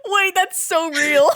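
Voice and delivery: real laugh breaking into the words; amused laughing remark; laughing agreement. Real, not acted. Host laughing at room tone advice.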